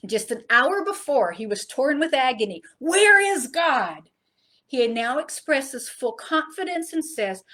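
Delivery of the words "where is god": higher, louder, very slow anguished question; agonized plea. Retold or imitated. Preacher voicing Jesus torn with agony, slow anguished cry. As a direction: drag it out in torment.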